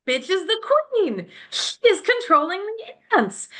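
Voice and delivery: high, sudden aha of discovery; high excited theorizing; excited realization. Retold or imitated. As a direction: blurt it like you just cracked the mystery. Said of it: "And I'm like".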